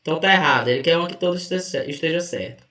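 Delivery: ordinary delivery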